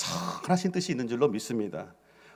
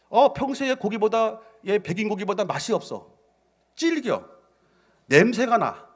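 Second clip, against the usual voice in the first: cannibal complaining; 8 semitones higher than usual. Acted. Cannibals complaining about meat.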